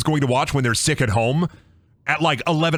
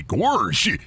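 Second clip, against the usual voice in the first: slow, swooping cartoon gorsh; Goofy. Shown as a demonstration. Streamer showing off his one cartoon catchphrase, goofy gorsh. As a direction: draw out a dopey gorsh. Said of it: "I can't do, like, a goofy voice, but I can do".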